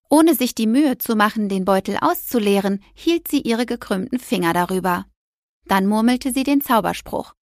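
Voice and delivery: usual speaking voice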